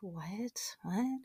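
hushed, whispered disbelief; stunned mock whisper. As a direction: imitate a shocked onlooker under the breath. Imitating stunned people on stage.

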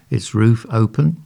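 ordinary delivery, nothing marked

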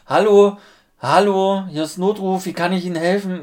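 high, loud call-centre hello; loud operator voice. Retold or imitated. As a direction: shout hello down a bad line.

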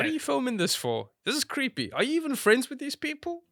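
high-pitched, disbelieving questioning; creeped-out, high-pitched protest; incredulous objection. Retold or imitated. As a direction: challenge them in a high, baffled voice. Acted reaction to creepy filming.

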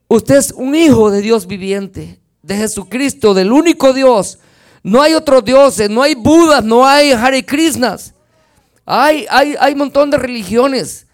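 fiery pulpit preaching; full-force preaching. Acted. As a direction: proclaim it to the congregation. Preaching, rising to a declaration.

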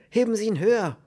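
high, loud, urgent order; called command. A narrator's reading. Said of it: "rief er". (laut und drängend gerufen)

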